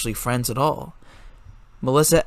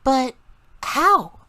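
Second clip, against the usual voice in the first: concerned; 12 semitones higher, 5 dB louder than usual. A narrator's reading. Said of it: "showed concern".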